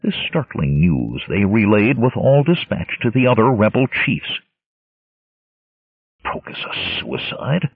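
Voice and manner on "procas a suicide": growled. Acted. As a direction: growl it under the breath. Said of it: "growled Conan".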